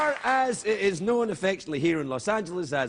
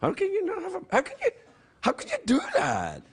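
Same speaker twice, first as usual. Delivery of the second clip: high outraged disbelief. Retold or imitated. Host mocking the parade organizers, high-pitched outraged disbelief. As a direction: voice jumping much higher in shocked protest. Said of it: "I'm like".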